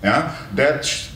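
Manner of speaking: ordinary delivery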